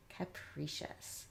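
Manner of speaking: "Capricious" slowly and softly as "kuh-PREE-shus"; long E. Shown as a demonstration. Teacher putting the sounds together, slow long E in the middle. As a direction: draw out "Capricious" with uh, then ee. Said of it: "letter I making an E sound".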